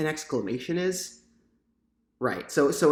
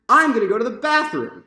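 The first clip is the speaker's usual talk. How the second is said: higher and louder, bursting with relief; excited exclamation; 8 semitones higher, 7 dB louder than usual. Shown as a demonstration. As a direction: announce it like you just found the bathroom. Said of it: "because we are excited".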